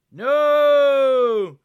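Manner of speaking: high, loud drawn-out "No!"; big dramatic scream of no; long anguished shout. A narrator's reading. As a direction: cry out in horror, holding the vowel. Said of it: "shouted the three young samurais".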